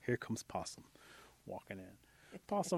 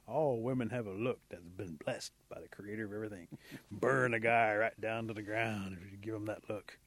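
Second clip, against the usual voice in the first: ordinary delivery, nothing marked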